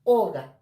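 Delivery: oga with a loud, stressed first O. Shown as a demonstration. Teacher repeats the example, first O of oga stressed. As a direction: hit the O of oga hard, let GA drop. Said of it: "vocal tónica".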